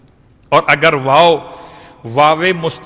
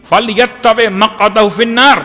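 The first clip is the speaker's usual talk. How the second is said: quick, higher chanted Arabic; fast raised recitation of the Arabic; Arabic quote; 5 semitones higher, much faster than usual. Acted. Quoting hadith in Arabic.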